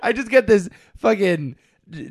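usual speaking voice

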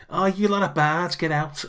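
high, loud mock Scouse beetle; Scouse accent. Beetle cook shooing the bards away, in a Scouse accent. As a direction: ham up a Liverpool accent as a cranky beetle cook. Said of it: "so he's mirroring the accent".

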